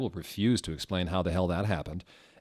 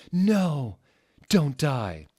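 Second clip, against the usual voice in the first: pleading; 8 semitones higher, 9 dB louder, much slower than usual. Acted. Satan begs dying Ebony.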